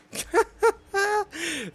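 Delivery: high, loud burst of laughter; amused laugh. Real, not acted. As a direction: laugh off your own defeat. Laughs after dying in game.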